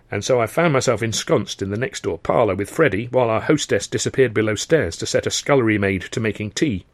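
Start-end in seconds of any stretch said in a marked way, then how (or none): none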